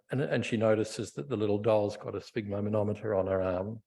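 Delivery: ordinary delivery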